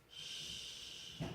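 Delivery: unvoiced hush; soft secretive shh; whispered shush. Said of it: "this is a secret".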